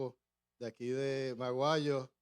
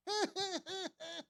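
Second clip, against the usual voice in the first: imitated laugh; 5 dB quieter than usual. Mimics friend's laugh again.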